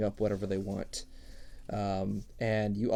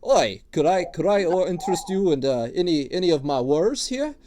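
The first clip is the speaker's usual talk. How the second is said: higher, louder wheedling dwarf voice; gruff dwarf tattooist; 5 semitones higher, 8 dB louder than usual. Acted. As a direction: sell your wares like an eager old dwarf. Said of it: "he looks at you and he goes".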